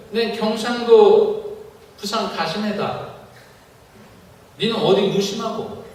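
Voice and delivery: Gyeongsang grandma. Retold or imitated. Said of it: "이 경상도 말로".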